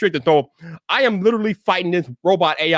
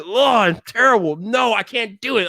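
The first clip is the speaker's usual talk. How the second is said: raised pitch, exaggerated revulsion; dramatic dismay; 6 semitones higher than usual. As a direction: recoil from it in acted-out horror. Quotes own dismay at boring videos.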